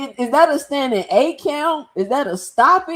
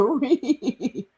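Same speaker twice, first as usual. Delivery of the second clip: normal talking voice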